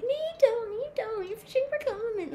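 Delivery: very high mocking voice, rushing at the end; mocking quote. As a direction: parody the commenters in a squeaky high voice. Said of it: "and not everyone be like".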